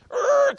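high-pitched imitated tire screech; screeching car noise. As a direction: imitate a car screaming to a stop. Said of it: "this car just comes screaming in".

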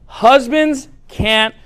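drawn out, with long pauses between words; slow, deliberate. Real, not acted. Pastor revealing a life-changing secret to the ladies, slow and deliberate. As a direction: spell it out very slowly.

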